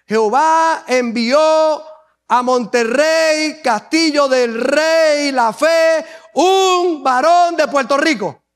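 slow, stretched-out scripture proclamation; grand declamation. Acted. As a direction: draw out each phrase, rising in pitch toward the end. Declaims his own version of verse.

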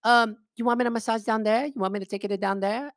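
high-pitched mock Chinese accent; imitating young Chinese masseuse. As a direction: offer it in a high girlish voice with a put-on Chinese accent. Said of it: "this young girl".

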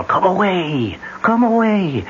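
rising, drawn-out wailing call of a ghost woman; eerie ghostly call. Retold or imitated. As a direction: lure them in like a spirit from the ruins. Ghost's call retold by [name].

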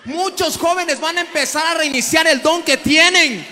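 loud fiery preaching; preaching shout. Acted. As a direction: proclaim it at full force to the crowd.

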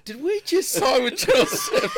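loud, high, through laughter; laughing disbelief. Real, not acted. Telling how the brothers landed a TV deal, laughing in disbelief. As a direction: crack up while telling it, pitch soaring.

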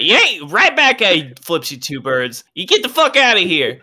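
high, loud mocking yell; patron yelling back. Patron flips birds, yelling.

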